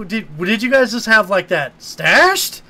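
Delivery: mock exasperated questioning; incredulous rant. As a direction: pile up the questions in disbelief. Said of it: "I'm like".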